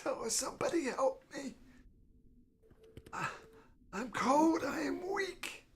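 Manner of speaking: put-on high, weak old lady pleading; frail old woman. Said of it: "this one lady says".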